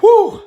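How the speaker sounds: long high-pitched whoop at the burn. Real, not acted. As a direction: let the heat out in a piercing whoo.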